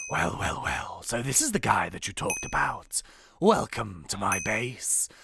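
low, gloating villain voice; low, drawn-out menace; menacing evoker villain. Acted. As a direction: welcome your victim like an evil boss.

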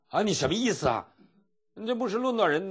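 high, loud acted protest; imitated indignant retort. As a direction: take offence loudly, pitch climbing. Acting the offended woman's retort. (模仿被冒犯时高声质问)

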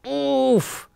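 high, drawn-out groan of effort; high squashed-sounding oof; strained oof. A narrator's reading. Mouse struggling with the fallen fridge, strained oof.

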